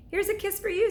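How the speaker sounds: put-on girl blowing a kiss through glass; gushing. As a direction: coo at the dolphin. Annie blows kiss to dolphin.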